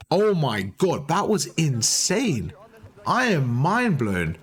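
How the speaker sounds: slow, awestruck disbelief; drawn out, genuinely blown away; incredulous. Real, not acted. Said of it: "I'm in disbelief right now".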